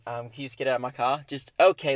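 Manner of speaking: ordinary delivery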